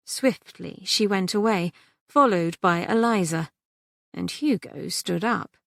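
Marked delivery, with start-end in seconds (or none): none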